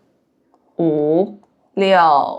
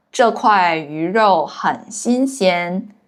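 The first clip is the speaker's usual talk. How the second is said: ordinary delivery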